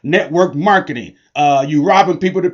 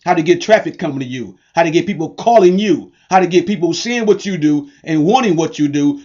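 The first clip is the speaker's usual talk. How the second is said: ordinary talk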